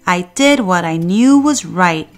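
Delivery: plain reading voice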